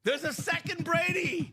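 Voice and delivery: high, laughing plea; excited coaxing. Pretend. Host tells Shoe Dog of another Brady, excited coaxing. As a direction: lure him back through a laugh. Luring buyer back, amused.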